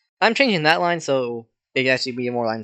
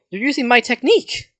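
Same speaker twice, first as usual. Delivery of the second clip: high-pitched, startled outcry; shocked accusation; 9 semitones higher, 3 dB louder than usual. Acted. Kendo seeing her technique copied, shocked accusation. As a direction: exclaim it in disbelief.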